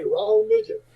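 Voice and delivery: high put-on squeak; very high, playful imitation; catchphrase in little man's voice. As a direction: mimic the tiny man's panicky line. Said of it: "We still say it to this day".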